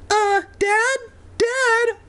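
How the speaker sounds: very high squeaky call; squeaking. Acted. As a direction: squeak up to dad. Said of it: "Evan squeaked up at him".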